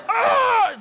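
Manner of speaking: sudden high, loud retching cry; mock scream. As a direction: yelp as if throwing up. Acting out being sick.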